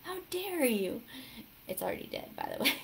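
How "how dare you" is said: mock-outraged, higher and drawn out; mad. Jesus rebuking the creature he is chopping up, mad. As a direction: play the indignant scolding, each dare stretched. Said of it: "just mad at this thing".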